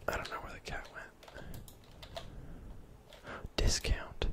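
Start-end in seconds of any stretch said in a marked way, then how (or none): 2.2-3.5 soft, voiceless gasp; hushed gasp. Real, not acted. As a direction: gasp quietly without voice. Gasps while whispering gameplay.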